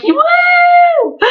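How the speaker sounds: very high, long imitated whoop; excited squeal. Fan re-enacting the selfie moment, long high-pitched whoop.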